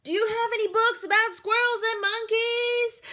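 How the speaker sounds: put-on high, loud eager kid; loud child voice. Girl asking the librarian, far too loud. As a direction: blurt the question to the whole library.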